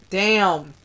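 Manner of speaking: ordinary delivery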